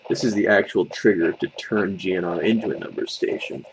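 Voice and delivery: ordinary delivery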